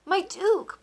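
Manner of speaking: high, loud begging; pleading. A narrator's reading. Baker begging the duke, high desperate plea. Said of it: "pleaded Walter".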